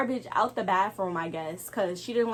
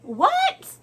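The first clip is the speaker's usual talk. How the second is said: very high, loud shocked yelp; shocked what; 6 dB louder than usual. Real, not acted. Reacting to the coworker's cousin moving in, shocked. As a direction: squeal it in disbelief.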